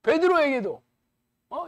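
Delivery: ordinary delivery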